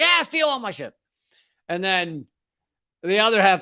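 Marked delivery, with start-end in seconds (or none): 0.0-0.9 high-pitched, careless giving in; mock exasperated surrender. User giving in to the website's data request, mock exasperated. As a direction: throw up the pitch and wave it away.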